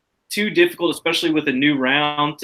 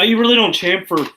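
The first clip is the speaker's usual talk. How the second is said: ordinary talk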